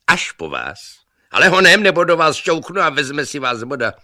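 very loud, high threatening yell; threatening shout. A narrator's reading. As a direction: threaten to push him in. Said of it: "křičeli na sebe".

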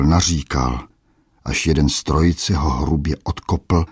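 plain reading voice

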